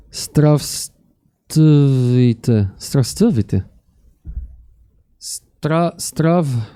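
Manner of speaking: sounding it out piece by piece; hesitant beginner reading Russian, breaking the word up; halting reading. Real, not acted. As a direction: labour through an unfamiliar Russian word. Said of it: "I can't read it that well yet".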